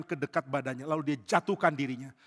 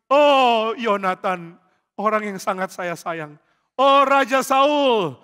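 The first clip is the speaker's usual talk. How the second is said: lament; 5 semitones higher, 10 dB louder, much slower than usual. Acted. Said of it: "Dia menangis".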